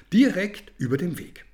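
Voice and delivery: usual speaking voice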